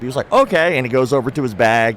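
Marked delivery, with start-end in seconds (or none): 0.3-0.8 higher, happily eager okay; happy. Retold or imitated. Instructor delighted at a knife technique request, happy. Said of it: "He was so happy".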